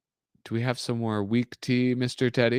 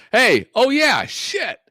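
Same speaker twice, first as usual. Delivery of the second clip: startled outburst; 7 dB louder, slower than usual. Real, not acted. Sudden shout while streaming.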